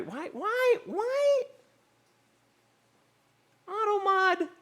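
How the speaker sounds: very high, squeaky mock whine; whiny squeak. Mock-whining at the Automod.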